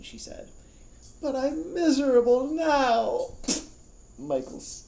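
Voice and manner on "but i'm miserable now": high, loud, slow wail; slow high complaining wail; protesting. Acted. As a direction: wail it miserably.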